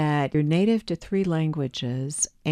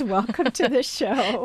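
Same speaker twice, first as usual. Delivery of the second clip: laughing; 7 semitones higher than usual. Real, not acted. Host laughing while welcoming guest.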